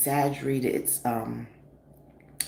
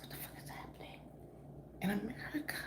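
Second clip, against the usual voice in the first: whispered aside in disbelief; hushed what-the-hell whisper; stunned whisper; 8 semitones higher, 15 dB quieter, faster than usual. Retold or imitated. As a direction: breathe it out in shock. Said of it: "I was like".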